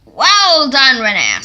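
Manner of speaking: loud praise. Acted. Scullery praises Renes.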